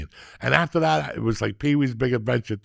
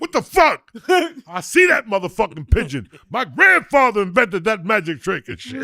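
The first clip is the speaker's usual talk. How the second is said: loud, high indignant yell; mock outraged shout; 8 semitones higher, 6 dB louder than usual. Heckling a magician's pigeon trick, outraged shout. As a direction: shout like you've been cheated. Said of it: "They'll be like".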